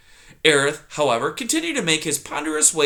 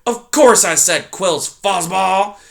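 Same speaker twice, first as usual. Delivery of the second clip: put-on loud, grumbling old porcupine; loud cranky old porcupine; grumpy old porcupine; 5 semitones higher, 7 dB louder, slower than usual. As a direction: snap at the intruder like a cranky old coot. Erith voiced in story.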